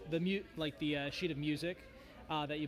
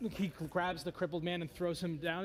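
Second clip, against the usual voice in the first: ordinary delivery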